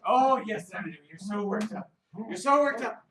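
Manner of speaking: high, lively dog-talk; high-pitched excited pet talk; excited praise. Acted. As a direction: egg the dog on playfully.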